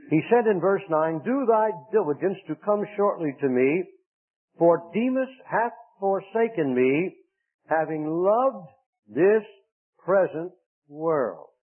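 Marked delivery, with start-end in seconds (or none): 1.2-11.5 solemn King James reading; scripture reading. Acted. Preacher reads scripture.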